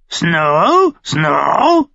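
high, loud shrieking bird call; shrill raven croak of a name; screeching raven. A narrator's reading. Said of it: "kreischte er ihn an".

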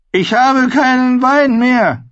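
hochmütiger Kindkönig, viel höher und lauter; announcing boy king. A narrator's reading. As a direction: proclaim it like a spoiled royal brat. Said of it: "verkündete Joffrey".

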